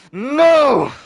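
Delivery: high, loud dramatic cry; drawn-out battle shout; shouted refusal. Acted. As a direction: bellow it in acted desperation. Acted cry of refusal in fight.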